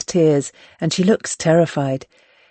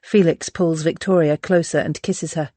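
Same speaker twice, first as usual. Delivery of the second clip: plain reading voice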